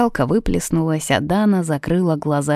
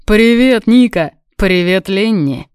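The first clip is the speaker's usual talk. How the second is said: louder, higher cheery hail; calling a greeting; 4 semitones higher, 6 dB louder than usual. A narrator's reading. Bruno hails Ника and Ленни, calling a greeting. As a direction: greet them loudly. Бруно громко здоровается с друзьями.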